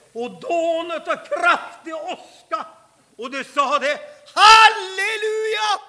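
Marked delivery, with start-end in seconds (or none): 4.3-5.8 a long, high, ringing shout of praise; exultant shout. Acted. Preacher voicing the waterfall's cry, exultant shout. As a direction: cry it out slowly like roaring water.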